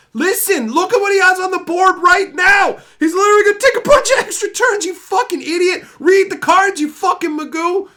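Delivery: very high, loud enraged yelling; furious shout. Retold or imitated. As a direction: scream at the friend to read the cards. Reenacted rage at friend.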